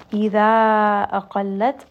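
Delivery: loud, very slow chant of "Hatta idha"; مد أربع حركات; recited four-count mad. Shown as a demonstration. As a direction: hold the long vowel for four counts. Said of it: "again, this is going to be a four count mad".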